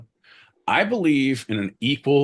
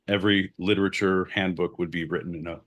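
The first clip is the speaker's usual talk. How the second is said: normal talking voice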